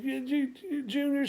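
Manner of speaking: high stammering start; flustered stammer. Retold or imitated. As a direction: trip over the name in shock. Stammering in surprise.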